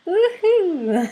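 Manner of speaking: high, loud joyful woohoo; whooping. Real, not acted. Speaker whooping as she recalls the joyful moment. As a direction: let out a happy whoop. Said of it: "I'm getting".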